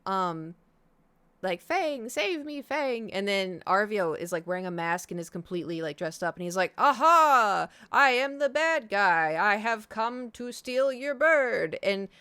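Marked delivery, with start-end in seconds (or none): 1.6-3.1 drawn-out high squeaky cry; slow, high little bird calling save me; slow high bird plea. Retold or imitated. Bird voiced pleading, drawn out. 6.8-11.8 hammy slow villain boast, loud and high; loud, slow, high mock villain gloating; goofy villain. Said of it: "it's all very, you know, goofy".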